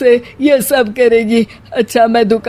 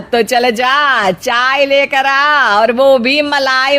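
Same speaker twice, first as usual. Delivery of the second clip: slow, drawn-out bossy command; slow gloating order; 3 semitones higher, slower than usual. Acted. Mother-in-law bossing the bahu, slow and gloating. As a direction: stretch every word, enjoying the upper hand.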